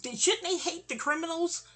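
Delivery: high, astonished questions; baffled disbelief, voice jumping up; incredulous. Retold or imitated. On the stoop to stepdad, high incredulous questions. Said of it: "I'm on a stoop with talking to him like".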